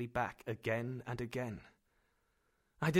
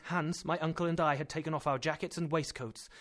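ordinary delivery, nothing marked

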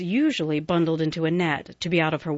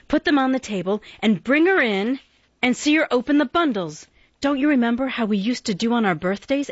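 put-on higher, louder eager girl's voice; bright-eyed sister keen on surprising mother; 6 semitones higher, 4 dB louder than usual. A narrator's reading. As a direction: rush the plan out with glee.

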